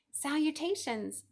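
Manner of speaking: a refined spider greeting from above; gracious spider. A narrator's reading. The thin voice greets him.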